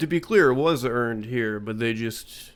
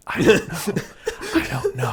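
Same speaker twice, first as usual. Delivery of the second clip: breathless laughing; 14 semitones higher than usual. Retold or imitated. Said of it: "they're like".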